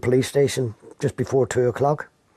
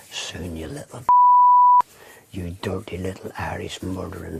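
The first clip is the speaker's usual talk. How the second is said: menacing growl; 5 semitones lower than usual. Retold or imitated. Retelling a detective's threat.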